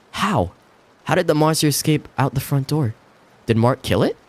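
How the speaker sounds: a touch of panic; confused fear. Acted. Confused frightened wife questioning.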